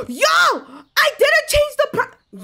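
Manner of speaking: high, loud, slow stretched shout of dismay; shocked cry. Real, not acted. Shop owner realising she forgot the price, shocked shout. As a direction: cry out as the mistake hits you.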